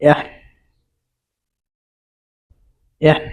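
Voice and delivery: "এহ" as an isolated eh; model vowel sound. Shown as a demonstration. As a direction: sound out "এহ" on its own, level and clear. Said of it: "উচ্চারণ হয়".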